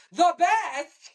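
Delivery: welling up. Retold or imitated. Little niece touched by praise for her card, mock tearful. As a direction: imitate a little girl welling up. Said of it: "she's welling up with tears".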